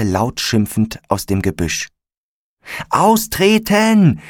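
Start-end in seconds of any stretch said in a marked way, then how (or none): 2.9-4.2 high, loud, drawn-out shout; shouted command. A narrator's reading. Felix to his friends as the grass burns, urgent shout. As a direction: call out the order urgently, stretching it. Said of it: "rief Felix Vorhelm".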